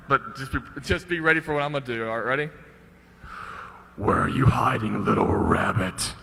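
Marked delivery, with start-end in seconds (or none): 4.0-6.1 low, slow menacing taunt; creepy killer. Acted. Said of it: "I'll be the bad guy or the serial killer".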